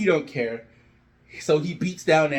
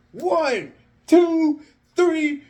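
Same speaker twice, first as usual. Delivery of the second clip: pinfall count; 10 semitones higher, 4 dB louder, much slower than usual. Acted.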